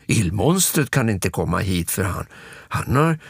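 plain reading voice

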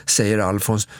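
ordinary delivery

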